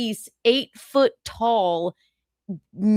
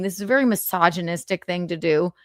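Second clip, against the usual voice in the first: ordinary talk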